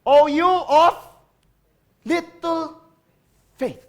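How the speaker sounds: loud, high, slow rebuke; Jesus rebuking. Acted. Jesus rebuking the disciples in the storm, loud measured rebuke. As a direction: call out each word apart. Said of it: "si Jesus said to them".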